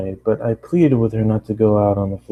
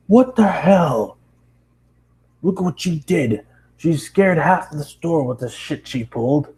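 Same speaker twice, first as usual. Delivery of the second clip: higher, slow, put-on boss voice chewing him out; angry boss; 7 semitones higher, slower than usual. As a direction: growl it out like a fed-up boss.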